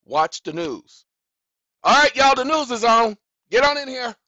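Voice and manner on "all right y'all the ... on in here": loud, raised call across the house; imitating mom hollering for everyone; mother calling kids in. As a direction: round up the kids for the news. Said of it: "My mother made us watch the news".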